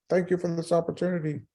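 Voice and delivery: high-pitched, gushing thanks; playful mock-grateful gush to his wife in labor; giddy thanks. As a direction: sound like a kid on a joyride. Thrilled by police escort, tells wife.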